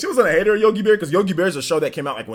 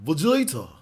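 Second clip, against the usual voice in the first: Zarbon. Acted. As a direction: address a rival as an anime villain. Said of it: "like, Zarbon".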